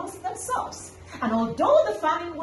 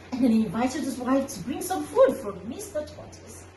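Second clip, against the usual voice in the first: usual speaking voice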